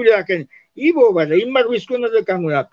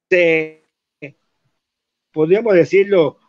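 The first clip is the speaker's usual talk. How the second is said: usual speaking voice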